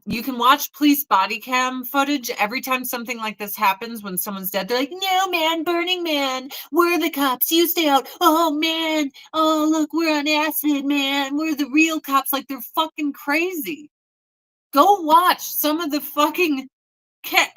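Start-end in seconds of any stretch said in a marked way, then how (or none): 4.9-12.3 whiny, higher-pitched druggie impression; stoner cop. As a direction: imitate a spaced-out festival cop. Mimicking stoned Burning Man police.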